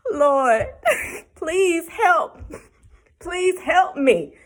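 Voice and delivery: high, laughing cry for help; laugh-cry Lord please help. Retold or imitated. Mom praying for help with stubborn daughter, laughing plea. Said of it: "I was like".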